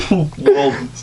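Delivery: high laughing fit; cracking up mid-read. Real, not acted. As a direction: breaks down laughing at the word. Reader cracks up at own text.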